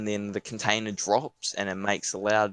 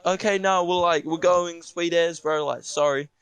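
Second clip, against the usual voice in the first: flustered apology; 7 semitones higher, 3 dB louder than usual. Retold or imitated. Hurried apology while backing off.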